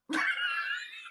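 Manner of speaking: very high squealing laugh; helpless laugh. Real, not acted. Comedian failing at accent, high helpless laugh.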